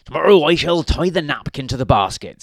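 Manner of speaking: loud, hearty storybook husband; big, emphatic character voice; Mister Grinling's voice. A narrator's reading. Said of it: "In fact, that was Mister Grinling".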